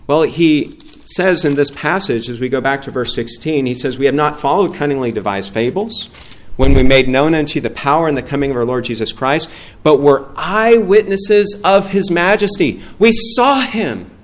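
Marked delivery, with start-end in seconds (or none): 4.0-12.8 scripture read out with rising force, louder at the end; preacher reading scripture with building force. Acted. 13.0-14.1 higher, louder and slow; preacher's emphatic declaration; emphatic exclamation. Real, not acted. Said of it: "preacher exclaims".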